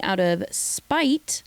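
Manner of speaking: ordinary talk, nothing marked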